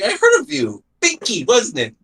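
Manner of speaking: high-pitched clown impression; Buggy. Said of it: "His first interaction with Luffy is fucking hilarious".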